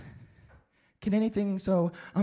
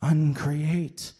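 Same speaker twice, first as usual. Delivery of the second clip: normal talking voice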